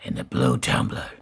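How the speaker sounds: deep rasping Batman voice. Pretend. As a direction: growl it like Bale's Batman.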